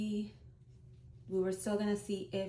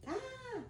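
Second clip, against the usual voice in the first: a sudden high, soft squeal of nerves; nervous squeal; 6 dB quieter than usual. Retold or imitated. Nervous about telling mom, a high squeal. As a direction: let out a tiny panicked squeak.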